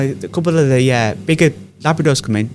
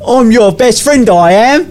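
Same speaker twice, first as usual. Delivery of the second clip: high, slow Cockney geezer toy voice, said I am your best friend; a talking toy turtle in a cheery Cockney voice; 9 semitones higher, 4 dB louder, much slower than usual. Retold or imitated. Retelling The Apprentice toy to a mate, high Cockney toy voice. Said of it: "he presses this little turtle and he goes".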